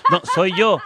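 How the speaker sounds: host laughing that it's him; laughing apology. Real, not acted. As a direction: laugh it off, sorry.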